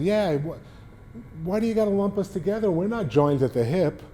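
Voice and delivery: high, fast whiny pitcher. Retold or imitated. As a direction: imitate a whiny ballplayer. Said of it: "Bruce had kind of a whiny voice".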